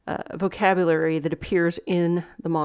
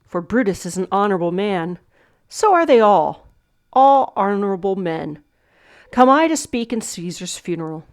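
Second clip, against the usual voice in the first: measured, slightly louder declaiming; Shakespeare recitation; 4 dB louder than usual. Shown as a demonstration. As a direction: read it out as a solemn verse line. Teacher reads monologue lines.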